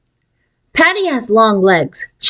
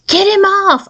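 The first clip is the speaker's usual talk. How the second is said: spluttering; 11 semitones higher than usual. A narrator's reading. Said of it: "I spluttered".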